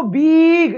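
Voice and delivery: बिग stretched to a long "beeeg"; mock wrong "beeeg" for बिग, vowel dragged out; drawn-out vowel. Shown as a demonstration. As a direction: hold the ee in बिग far too long. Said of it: "इसको बिग ऐसे थोड़ी बोलते हैं".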